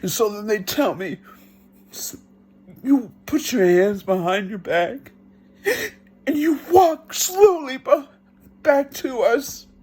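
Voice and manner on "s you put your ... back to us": high, deliberate order, slowing at the end; acted police command. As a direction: imitate an officer giving careful, high-pitched instructions.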